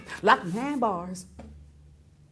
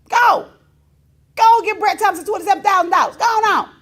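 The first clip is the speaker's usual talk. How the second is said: mock urging; 7 semitones higher, 8 dB louder than usual. Mock urging George to pay.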